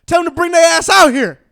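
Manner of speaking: shouted challenge, high and very loud; loud angry callout. Real, not acted. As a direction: holler it out like calling out rivals. Profane challenge hollered at record label.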